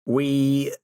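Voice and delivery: normal talking voice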